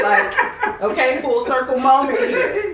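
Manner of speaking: loud, high laughing, near tears; laughing tearfully. Real, not acted. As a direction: overcome with joy. Emotional full circle moment.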